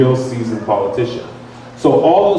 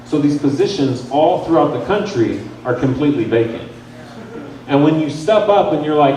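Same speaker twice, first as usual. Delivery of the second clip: ordinary talk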